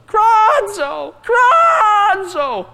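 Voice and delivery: very high, loud squawked word; raven's cry. Shown as a demonstration. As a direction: screech it out like a raven. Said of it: "a cry of a raven".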